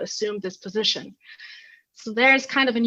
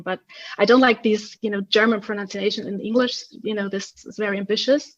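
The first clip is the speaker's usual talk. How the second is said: ordinary talk, nothing marked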